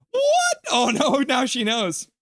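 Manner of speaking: high, loud, hammed-up dismay; comic gasping alarm, pitch shooting up; mock shock. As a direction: squeal in pretend panic at being found out. Mock shock at secret being out.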